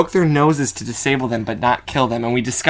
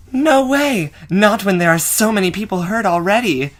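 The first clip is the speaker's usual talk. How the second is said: Cynthia's protest; 8 semitones higher than usual. Acted. Cynthia refuses, voiced line.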